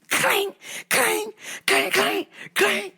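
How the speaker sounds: high, ringing vocal clangs; sharp high bursts, like smashing metal; clanging metal imitation. Storyteller imitating the pump being smashed on the street, high clangs. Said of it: "you could probably hear it".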